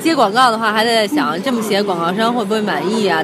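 ordinary talk, nothing marked

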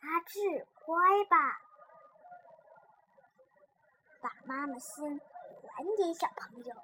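soft, patient teacher with long pauses; gentle coaxing. A narrator's reading. Teacher asking A-Zhi to return the heart, gentle and soft. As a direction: coax a child tenderly, dropping very soft. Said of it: "老师都要更温柔地说".

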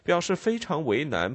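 ordinary delivery

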